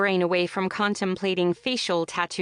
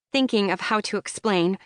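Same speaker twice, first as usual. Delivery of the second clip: usual speaking voice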